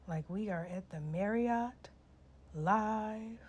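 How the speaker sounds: ordinary talk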